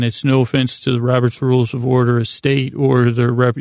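usual speaking voice

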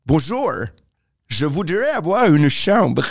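French with a put-on British accent, drawn out slowly; accent british en français. Shown as a demonstration. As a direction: play an English speaker labouring slowly through French. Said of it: "je prends l'accent british en français". (accent britannique exagéré, en français lent)

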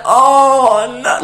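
high re-enacted "oh no"; drawn-out cringing moan; cringe said aloud. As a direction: groan it out in mock embarrassment. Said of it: "to myself, but said aloud".

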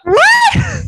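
very high, loud shocked shriek. Real, not acted. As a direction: screech it in disbelief. Hearing friend's salon story.

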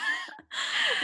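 very high laugh near tears; shrieking laugh. Real, not acted. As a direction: laugh till it cracks. Laughing at doorbell story.

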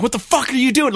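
high-pitched mock yell, jolted awake; half-acted startled scream at the wife; retold scream. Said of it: "I just go scream".

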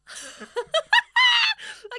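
loud high honking squawk; goose honk. Pretend. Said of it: "do my goose impression".

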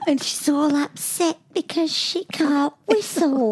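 imitating Peppa. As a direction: do a light impression of the cartoon's storyteller. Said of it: "Don't you think she's a little bit guttural?".

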